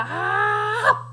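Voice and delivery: big high open-mouthed "ah"; gobbling "ah". Shown as a demonstration. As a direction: open wide and chomp it down. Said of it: "Can you open your mouth and do that?".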